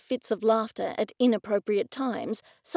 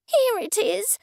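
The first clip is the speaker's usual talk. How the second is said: high-pitched, nervy announcement; nervous presentation; 10 semitones higher than usual. A narrator's reading. Artist shows gallery owner her canvases, in a high nervous voice. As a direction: pitch it way up, anxious to show the work.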